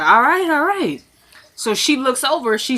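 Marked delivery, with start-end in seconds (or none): 0.0-1.0 giving in. Retold or imitated. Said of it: "And he was like".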